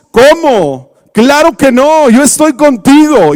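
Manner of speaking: high, protesting voice; indignant Peter. Retold or imitated. Peter protesting to Jesus at the supper, high and indignant. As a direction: insist loyally, pitched high. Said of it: "yo me lo imagino que se levanta y dice".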